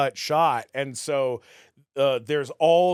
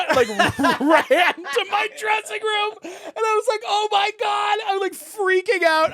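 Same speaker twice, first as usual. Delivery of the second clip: high, loud, laughing excitement; freaking out; 6 dB louder than usual. Retold or imitated. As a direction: gush with giddy panic. Retelling freaking out.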